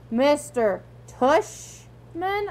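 drawn out very slowly in disbelief. Acted. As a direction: stretch the name out slowly. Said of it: "I repeated".

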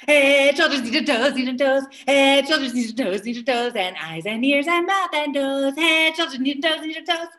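loud, rushed chant. Shown as a demonstration. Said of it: "we can go much, much faster".

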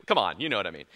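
usual speaking voice

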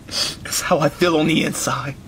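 low, heavy-hearted confession; despondent. Acted. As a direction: confide it in deep gloom. Depressed father confides.

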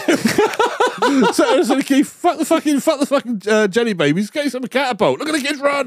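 high-pitched excited call, hammed up; mock excited calling. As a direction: call out to a mate in mock glee. Acting out his excited call to Keith.

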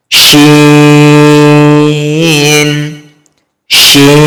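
শিন held long, three alif beats; drawn out shin. Shown as a demonstration. Teacher shows students how to draw out শিন. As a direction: stretch শিন slowly. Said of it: "সেভাবে আমরা উচ্চারণ করব।".

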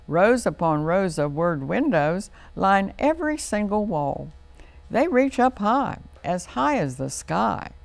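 sing-song rhyming recital, lifted and loud; poem recital. Acted. Host reciting the library poem to children, sing-song. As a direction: recite it like a nursery rhyme for kids.

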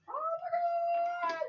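very high, slow, drawn-out gasp of awe; stunned squeal. Real, not acted. Overwhelmed squeal at a friend's present. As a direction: let it out long and high.

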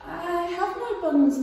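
small and scared; timid squirrel. Acted. Squirrel answering the rat, high and timid. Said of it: "the squirrel replied".

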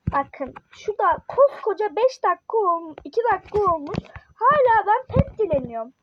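worked-up, frustrated rant; exasperated. Real, not acted. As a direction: gripe in fed-up disbelief, building to a sarcastic finish. Fed up with waiting for trades.

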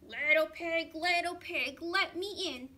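loud, gruff big bad wolf. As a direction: call out like the wolf at the door. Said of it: "said the wolf".

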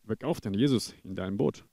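loud, driving sermon delivery; full-force preaching. Acted. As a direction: preach hard to the church family.

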